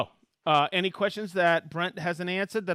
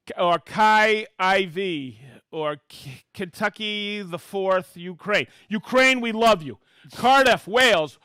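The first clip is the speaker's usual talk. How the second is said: upbeat, louder roll-call of hometowns; excited shout-outs; 6 semitones higher, 6 dB louder than usual. Acted. Host reading viewer hometowns to the live audience, excited and loud. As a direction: call each town out like a game-show host.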